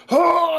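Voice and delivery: mock pained yelp. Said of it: "just went".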